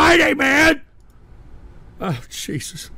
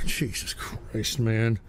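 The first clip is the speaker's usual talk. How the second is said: ordinary talk, nothing marked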